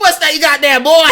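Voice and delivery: loud, high southern holler; hammed-up southern bluster with laughter; blustering southern man. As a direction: bellow it as a cartoon southerner. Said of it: "the southern man".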